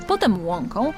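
ordinary delivery, nothing marked

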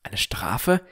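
whispered, rising disbelief; a boy whispering his shock at the punishment. Acted. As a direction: breathe it out in disbelief. Narrator shocked inner question.

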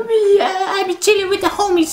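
high silly voice for his own reply; mock answer. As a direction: play yourself answering the teacher, hammed up.

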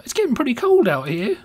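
chatty Englishman remarking on the chill; British accent. Acted. Gamer voicing Winston in a British accent. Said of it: "I forgot what voice I was using".

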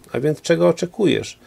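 a touch of exasperation; irritated. A narrator's reading. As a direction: demand an answer with mild irritation. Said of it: "pytał rozdrażniony".

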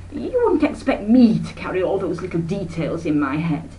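high, bright-voiced busy aunt rattling on; a breezy society lady who never stops talking; breezy chattering aunt. Aunt Emma chatters to her sister, airy and high.

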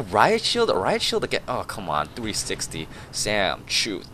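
usual speaking voice

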